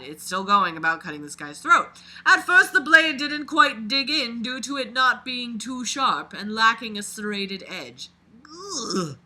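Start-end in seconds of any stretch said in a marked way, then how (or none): 2.3-8.1 reading a dark first-person story aloud, a bit louder; dramatic read-aloud of a creepy story. A narrator's reading. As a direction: narrate it like a confession being read out. Reads the killer's story aloud.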